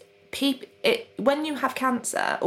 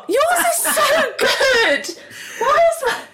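delighted surprise; 6 dB louder, slower than usual. Real, not acted. Admiring the other pot.

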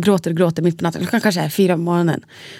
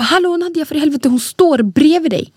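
much higher, louder exasperated yell; husband's exasperated shout; 8 semitones higher, 4 dB louder than usual. Retold or imitated. Husband yelling that the baby is beside her, exasperated. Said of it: "Så han bara".

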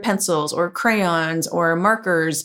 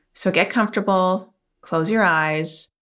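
guided meditation. Acted. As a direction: calmly guide the listener inward. Leads a guided visualization.

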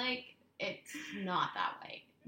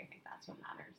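soft unvoiced whisper; 13 dB quieter than usual. Real, not acted. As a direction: breathe it without voice. Whispering aside.

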